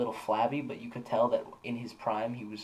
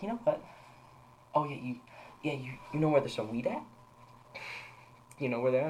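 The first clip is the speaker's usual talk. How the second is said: jokey. Retold or imitated. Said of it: "playing it off as a little bit of a bit".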